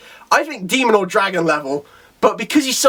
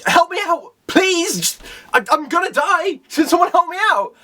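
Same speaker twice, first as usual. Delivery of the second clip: hammed-up, high-pitched cry for help; desperate pleading; 11 semitones higher than usual. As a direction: beg for rescue in a mock panic. Desperate character begging for help.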